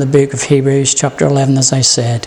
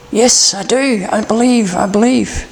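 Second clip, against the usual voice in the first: higher, eager reassurance; eager believer; 6 semitones higher than usual. Acted. Man on the cliff insisting he believes, eager. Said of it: "hanging man answering God".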